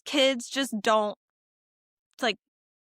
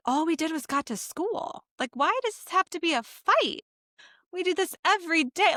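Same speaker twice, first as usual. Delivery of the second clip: exasperated mock complaint; 8 semitones higher than usual. Mom quoting her own frustration.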